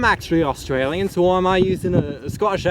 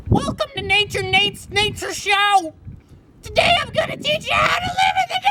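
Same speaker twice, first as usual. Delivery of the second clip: hammed-up show host; 13 semitones higher than usual. Nature Nate welcoming viewers to his show, hammed-up. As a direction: strain out a grand show welcome. Over-the-top show intro.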